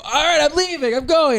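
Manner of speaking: high, exasperated retold announcement; worked-up mock huff; upset exit. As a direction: pitch it right up, fed up and going. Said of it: "and I go".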